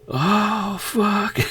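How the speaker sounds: high, loud imitation of a scared viewer; mock audience freakout. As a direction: squeal like a spooked seventies crowd. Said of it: "everybody's just like".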